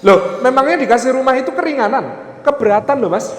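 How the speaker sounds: high-pitched disbelieving retort; mock incredulity. Preacher challenges the husband's prayer for a house, mock incredulous objection. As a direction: object as if taken aback.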